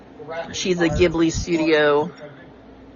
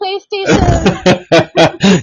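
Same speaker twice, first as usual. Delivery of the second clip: loud and slow, laughing through it; hammed-up ancestral scolding, cracking up; mock dishonor scolding; 9 dB louder, slower than usual. Friend scolding a gamer pal, laughing.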